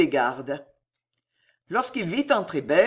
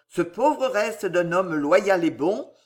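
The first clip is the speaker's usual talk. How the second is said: ordinary delivery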